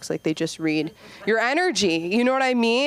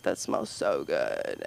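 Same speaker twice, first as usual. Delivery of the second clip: low, slow, quiet mock man's voice; a customer sniffing the room spray; deep man's voice; 7 dB quieter, much slower than usual. Guy comments on spray.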